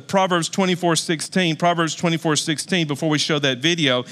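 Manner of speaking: ordinary talk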